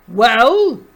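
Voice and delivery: big booming demand; very loud, commanding character shout. A narrator's reading. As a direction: boom it out like a huge marshal. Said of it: "boomed Zeno".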